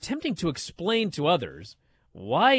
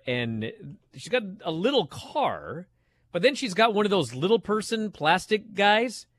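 ordinary talk, nothing marked

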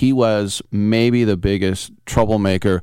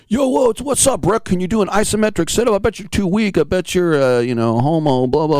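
taunting jocks; 9 semitones higher than usual. Retold or imitated. Locker room linemen taunting him.

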